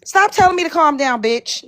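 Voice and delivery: ordinary talk, nothing marked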